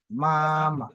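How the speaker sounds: MAMA with first syllable held high and level, then dropping; first-tone example. Shown as a demonstration. Teacher giving a first-tone word, MAMA high and drawn out. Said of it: "Comme un exemple de ma".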